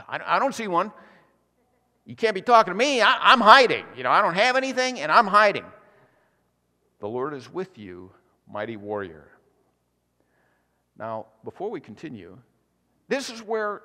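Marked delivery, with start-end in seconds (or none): 0.1-5.6 rising, louder panicky pleading; frightened protest. Acted. Timid Gideon to the angel, frightened louder protest. 7.0-9.3 softer, lower and calm; calm divine reply. Acted. Angel to frightened Gideon, soft calm reassurance.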